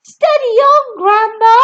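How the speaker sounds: high, loud warning; boy calling up to wild Grandma; alarmed call. Acted. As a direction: shout up to calm her. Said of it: "George said".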